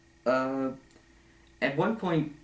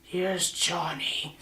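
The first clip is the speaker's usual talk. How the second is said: slow, higher, softer movie-villain quote; Here's Johnny impression; 6 semitones higher, 4 dB quieter, much slower than usual. Villain breaking in with an axe, slow creepy quote. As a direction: drawl it out like the famous axe scene. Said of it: "he was immediately gonna go".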